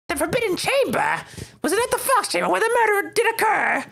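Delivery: put-on high, theatrical old man; old mayor voice. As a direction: ham up the pompous old mayor.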